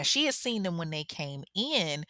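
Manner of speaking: usual speaking voice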